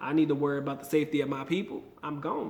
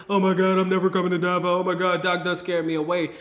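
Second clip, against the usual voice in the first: louder, hammed-up alarm in one breathless run; mock panic; 2 semitones higher, 7 dB louder than usual. Vlogger mimicking a viewer scared off from Davao, mock panic. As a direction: overreact loudly, as a mock scaredy-cat. Imagined overreaction he disclaims.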